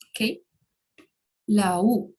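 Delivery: ordinary delivery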